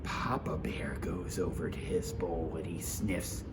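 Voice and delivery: low hushed whisper; whispered suspense. A narrator's reading. Narrator building suspense for listeners, whispered. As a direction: breathe it like a secret.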